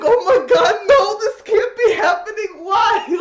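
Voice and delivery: high, loud, crying panic; hammed-up terrified wail; terrified Vegeta. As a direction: wail it like the worst news ever. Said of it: "he's, like, terrified".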